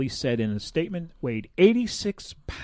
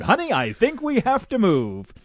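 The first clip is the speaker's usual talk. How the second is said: higher and louder, hamming up the husband's line; mock-dramatic husband quote; 10 semitones higher, 7 dB louder than usual. Host hams up the husband's words to his wife, mock-dramatic. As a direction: play up the deadpan quote for laughs. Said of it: "that's quote unquote".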